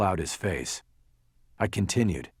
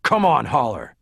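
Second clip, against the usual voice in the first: loud, higher impatient bark; 4 semitones higher, 7 dB louder than usual. A narrator's reading. Detective cuts off lawyer's excuse, barking. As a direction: cut in sharply and loudly. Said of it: "Bosch barked at me".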